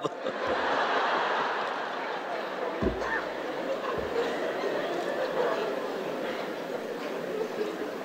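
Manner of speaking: voiceless shaking laugh; real silent laughter. Real, not acted. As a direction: laugh breathlessly with no voice. After punchline.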